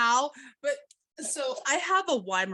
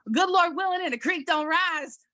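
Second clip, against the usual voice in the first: high, hammed-up Southern grandpa holler; yelling Kentucky grandpa; 9 semitones higher than usual. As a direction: imitate a grandpa calling out in thick Kentucky twang. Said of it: "my grandpa in the background yelling".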